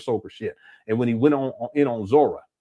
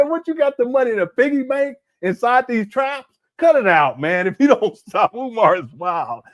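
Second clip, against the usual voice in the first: high, laughing disbelief; laughing mock retort; 10 semitones higher, 4 dB louder than usual. Mocking Umar's story about stolen money, laughing scoff. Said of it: "I said".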